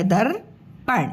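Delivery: दर्पण drawn out slowly, half र before प; teacher spelling out दर्पण; slow model word. Shown as a demonstration. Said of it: "यहाँ पे प के ऊपर वो रेफ आ गया".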